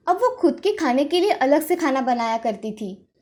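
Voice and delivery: plain reading voice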